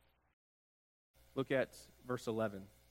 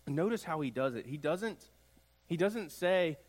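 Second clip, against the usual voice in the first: ordinary talk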